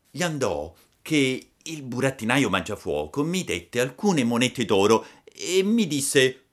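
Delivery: high, loud puppet recounting; Pinocchio narratore. A narrator's reading. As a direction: tell your adventure like an eager child. (burattino vivace che racconta, voce acuta)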